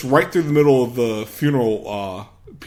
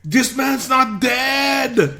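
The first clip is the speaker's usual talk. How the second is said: high, slow, shouted announcement; mock announcement; 11 semitones higher, much slower than usual. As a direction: call it out to the crowd, hammed-up. Arnold announcing at funeral.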